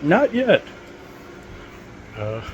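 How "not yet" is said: high-pitched retort, imitating the leader; imitated biker leader. Biker leader answering the joke, imitated high-pitched retort. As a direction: throw it out high as the leader's punchline. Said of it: "and the leader went".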